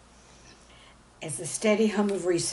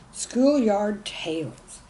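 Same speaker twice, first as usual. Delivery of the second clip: title announcement; 2 semitones higher, 5 dB louder, much slower than usual. A narrator's reading.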